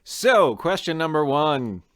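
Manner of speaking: high and loud, bursting with energy; fast, loud, high-pitched host energy; excited. Real, not acted. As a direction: jump in loud and bright to kick off the quiz. Host bursts in after fake-out.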